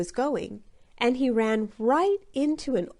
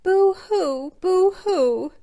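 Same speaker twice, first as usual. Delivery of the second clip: loud crying; 10 semitones higher, 7 dB louder, slower than usual. A narrator's reading. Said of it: "Little Bear cried".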